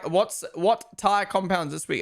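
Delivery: ordinary talk, nothing marked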